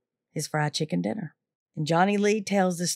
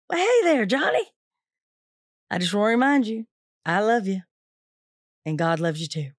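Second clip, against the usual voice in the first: bright, higher friendly greeting, softening at the end; cheerful warmth; 3 semitones higher than usual. Retold or imitated. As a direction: unshaken cheerful welcome. Watts answers threatening phone call.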